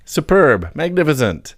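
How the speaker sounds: overdone praise with a tongue-in-cheek lift; ironic praise. Retold or imitated. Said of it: "So it was a poem saying".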